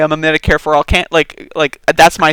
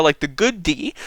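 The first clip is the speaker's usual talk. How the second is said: normal talking voice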